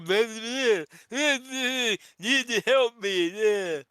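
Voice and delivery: high, loud, slow babbling voice; bad kid impression. Podcaster acts out the skit actor's fake kid, high babble. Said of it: "I can't do a good kid".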